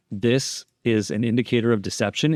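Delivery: ordinary talk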